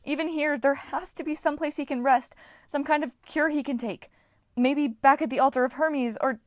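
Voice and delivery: desperate, rushed plea; Annabeth. A narrator's reading. Annabeth pleading.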